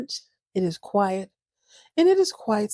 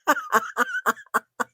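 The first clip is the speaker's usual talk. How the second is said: high laugh. Real, not acted. Laugh at own joke.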